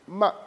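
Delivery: "Ma" short and quick, without tone movement; neutral tone. Shown as a demonstration. Teacher showing the fifth tone, "Ma" quick and neutral. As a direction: say "Ma" briefly and lightly. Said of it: "Voilà le ton neutre".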